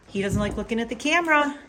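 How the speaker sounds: ordinary talk, nothing marked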